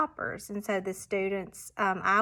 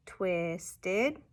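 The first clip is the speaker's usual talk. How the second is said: "Twisted" slowly split into twist and ed; syllable-by-syllable "Twisted"; parts separated. Shown as a demonstration. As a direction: say "Twisted" in two parts, left hanging. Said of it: "substituting parts of a word".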